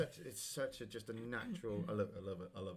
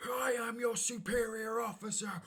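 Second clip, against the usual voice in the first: high, drawn-out outraged officer impression; mock-indignant rant, slow and high; going off on one; 11 semitones higher than usual. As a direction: imitate a superior officer losing it, pitched way up. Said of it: "Captain Holt goes off on one".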